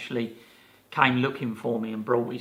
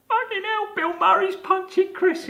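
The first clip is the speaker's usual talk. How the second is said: much higher mock squeal of disbelief; stunned disbelief; 4 dB louder than usual. Castmates just out of shot watching Bill Murray, mock stunned. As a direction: blurt it like you cannot believe your eyes.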